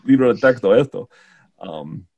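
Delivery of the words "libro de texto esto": put-on Argentine accent. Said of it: "girl from Argentina".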